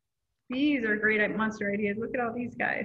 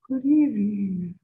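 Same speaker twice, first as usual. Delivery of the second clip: small, breathy monster greeting; little breathy voice; 2 semitones lower, 6 dB louder, slower than usual. Acted. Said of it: "said a little breathy voice".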